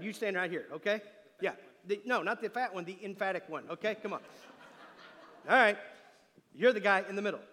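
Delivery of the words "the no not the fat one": normal talking voice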